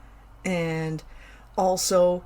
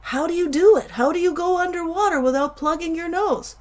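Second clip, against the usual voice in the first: put-on puzzled kid asking; 9 semitones higher than usual. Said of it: "If I asked group A".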